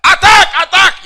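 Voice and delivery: high, loud yell; mock coach shouting attack. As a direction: scream the order across the field. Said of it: "once that guy they shout".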